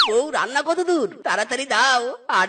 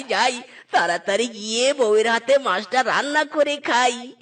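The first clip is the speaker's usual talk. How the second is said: ordinary talk, nothing marked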